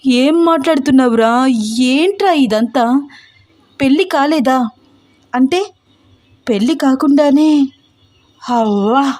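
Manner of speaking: a mother who can't believe her ears; gasping in shock, pitch climbing with each question; shocked disbelief. Acted.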